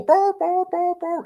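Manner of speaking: high yelping dog cries, mimicked; imitating a hurt dog. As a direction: yelp way up high like a dog in pain. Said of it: "'cause he's all".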